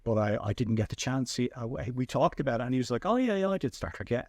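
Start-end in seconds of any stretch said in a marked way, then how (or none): 3.0-4.2 imitating a casual, higher-pitched brush-off; offhand recollection. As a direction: toss it off as just another job. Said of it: "it was just a gig to him".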